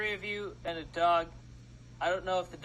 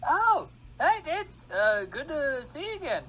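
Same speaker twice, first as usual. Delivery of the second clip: high, loud, cheerful greeting voice; bright high shopkeeper greeting, much louder; librarian NPC voice; 10 semitones higher, 6 dB louder than usual. Acted. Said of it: "Why does it sound like Jeff?".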